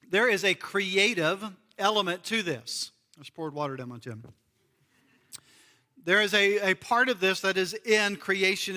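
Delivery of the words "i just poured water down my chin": low, soft, quick throwaway aside; quiet aside. Real, not acted. Preacher admits spilling water mid-sermon, hushed aside. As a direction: drop the voice and toss it off quickly.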